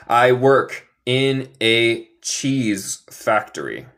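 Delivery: slowly and clearly, cheese factory; slow model sentence. Shown as a demonstration. Teacher slowly models the right English word order. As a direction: spell it out slowly for learners. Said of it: "Esto es como hablamos en inglés".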